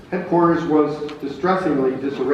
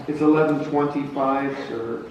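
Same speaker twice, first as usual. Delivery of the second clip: usual speaking voice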